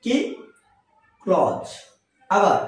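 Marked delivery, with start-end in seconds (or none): 1.2-1.6 ক্লজ in one syllable; ক্লজ with buzzed ending, no th; correct pronunciation. Shown as a demonstration. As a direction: say ক্লজ as "kloz". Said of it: "এর আসল উচ্চারণ".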